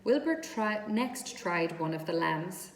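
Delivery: usual speaking voice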